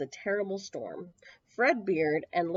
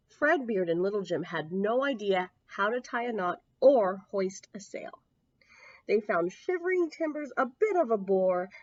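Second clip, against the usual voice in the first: usual speaking voice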